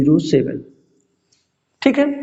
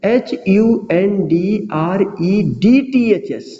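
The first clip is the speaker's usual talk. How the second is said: letter by letter, slowly; slow spelling; much slower than usual. Shown as a demonstration. Teacher spelling hundredths letter by letter for students, slow dictation. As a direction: spell it out slowly so they can copy. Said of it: "ध्यान से देखिएगा".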